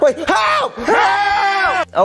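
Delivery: high-pitched, drawn-out cry; panicked call for help; yelling for help. Acted. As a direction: shout desperately to be rescued.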